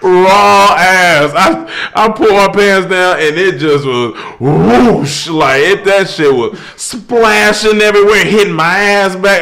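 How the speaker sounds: high, loud storytelling broken by laughs; laughing excited retelling. Real, not acted. Telling friends about the bathroom while laughing. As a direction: rush through it cracking up, pitch way up.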